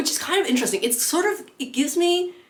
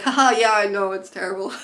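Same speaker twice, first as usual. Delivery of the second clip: put-on laugh, going along with it; mock people-pleasing reply with a forced haha; awkward agreement; 4 dB louder than usual. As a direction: pretend to agree to dodge confrontation. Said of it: "I'm just so bad at confrontation".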